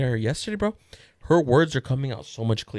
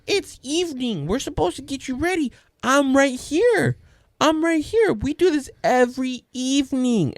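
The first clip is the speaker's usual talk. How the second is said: put-on high, emphatic little-girl voice; imitated big sister; 11 semitones higher, 3 dB louder than usual. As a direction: mimic a child reasoning with a fussy baby. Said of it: "She goes".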